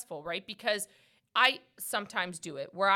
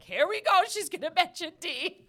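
high, loud teasing imitation; playful mock complaint, pitched way up; mock exasperation; 9 semitones higher, 6 dB louder than usual. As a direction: tease them with a loud, high mimicked groan-along. Teasing impression of host's complaint.

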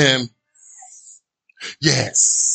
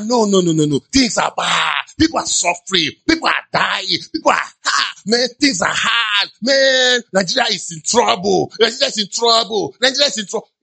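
rising mock wail, building with each line; mock lament; 4 semitones higher than usual. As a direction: wail it like the sky is falling. Mock lament about bad times.

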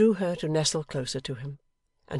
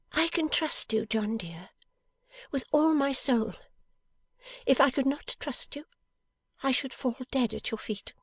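high, breathy declaration of trust; high-voiced, impassioned loving wife; devoted wife, fervent; 10 semitones higher than usual. A narrator's reading. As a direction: pour out your faith in a high wifely voice.

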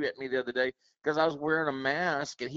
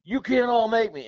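higher, louder mock defiance; 9 semitones higher, 6 dB louder than usual. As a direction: throw it back like a stubborn customer. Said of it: "telling him".

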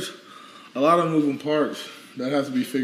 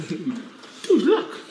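excited squeal; 5 semitones higher than usual. Real, not acted. Excited at the dog again.

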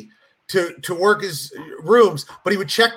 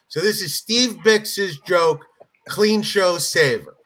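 ordinary delivery

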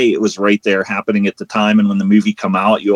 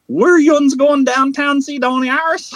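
put-on Pittsburgh accent. Pittsburgh local asking who's going downtown, thick Pittsburgh accent. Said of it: "the Pittsburgh accent".

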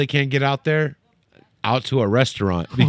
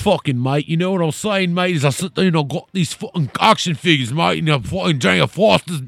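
higher-pitched, hammed-up Aussie bloke; mock Australian; 4 semitones higher than usual. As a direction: play a sweary Australian drinker. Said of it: "the most Australian dudes ever".

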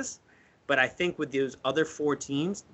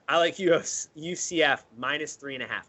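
ordinary delivery

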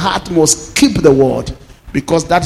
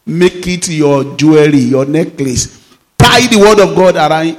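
ordinary talk, nothing marked

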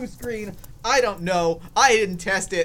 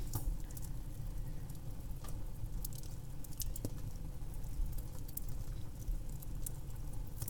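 wheezing unvoiced laugh; breathless silent laugh; 17 dB quieter than usual. Real, not acted. Vlogger wheezing at his broken blue screen, silent laugh. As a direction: laugh without voice.

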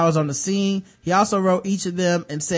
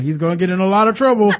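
laughing fake dad; 2 semitones higher, 6 dB louder, faster than usual. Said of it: "my brother pretended to be my dad".

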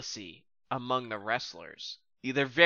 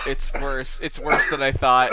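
ordinary delivery

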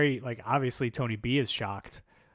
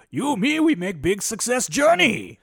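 broken English impression; 10 semitones higher, 8 dB louder than usual. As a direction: ham up Tony B's broken English. Imitating Tony B's broken English.

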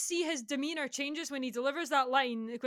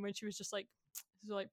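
ordinary talk, nothing marked

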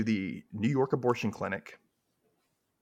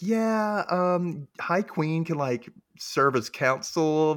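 hesitant courtier; 6 semitones higher, much slower than usual. Retold or imitated. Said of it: "Tick's like".

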